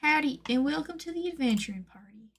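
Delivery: high, whispery imitation of a character greeting; light, airy mascot welcome, half-remembered; Jeline. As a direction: put on a high, hushed character voice. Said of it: "or whatever she says".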